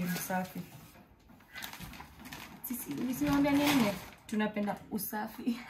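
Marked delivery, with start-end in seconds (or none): none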